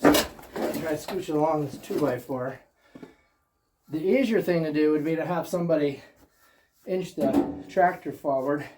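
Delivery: ordinary talk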